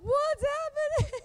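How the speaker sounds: high, bewildered squeak; stunned. Retold or imitated. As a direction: sound totally lost and startled. Stunned teen reaction.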